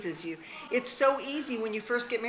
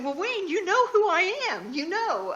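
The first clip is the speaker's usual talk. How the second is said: high, loud, protesting retort; mock indignant; 10 semitones higher, 5 dB louder than usual. Wife reassuring husband who doubts her desire, mock indignant protest. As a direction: protest high, as if it were obvious.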